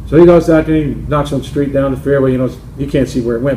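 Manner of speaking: usual speaking voice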